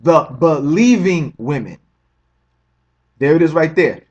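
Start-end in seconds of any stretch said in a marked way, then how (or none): 0.0-1.8 "believing" drawn out slowly and pressed; slow down and weight "believing"; slow emphatic stress. Real, not acted. Answering his own question to viewers, slow and emphatic.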